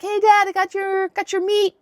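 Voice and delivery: high, lightly sing-song call to Dad; cheerful son. Retold or imitated. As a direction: imitate a son proudly announcing dinner. Son arrives with food for father.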